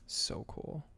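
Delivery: breathy whisper of awe. Acted.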